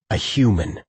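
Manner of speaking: understated disgust on a falling tone; a touch of contempt; spat with revulsion. A narrator's reading. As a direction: spit the word out with some revulsion. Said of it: "spat the last with as much revulsion".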